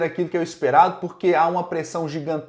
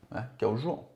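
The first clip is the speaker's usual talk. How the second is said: quiet aside; 6 semitones lower, 11 dB quieter, faster than usual. Real, not acted. Soft repeated aside.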